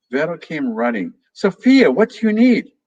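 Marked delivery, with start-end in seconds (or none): 1.3-2.7 high, loud eager call. Acted. Little Vero runs in calling for Sophia, loud high call. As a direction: shout back across the room, higher.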